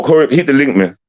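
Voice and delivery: normal talking voice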